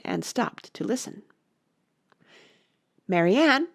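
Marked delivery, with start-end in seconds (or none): 3.1-3.7 raised calling voice. A narrator's reading. Rabbit calls for his maid, loud calling. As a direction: call loudly through the house. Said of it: "said the voice".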